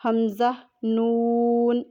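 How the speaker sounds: slow drawn-out letter names; spelling recitation. Shown as a demonstration. Teacher spelling the word aloud for students, drawn out. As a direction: chant the letters slowly. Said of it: "اس کا ہم ہجا کیسے کریں گے؟".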